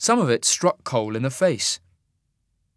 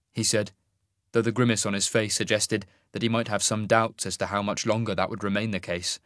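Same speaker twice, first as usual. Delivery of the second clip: usual speaking voice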